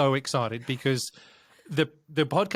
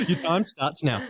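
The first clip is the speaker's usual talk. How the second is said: laughing; 7 semitones higher than usual. Real, not acted. Joking about the time limit.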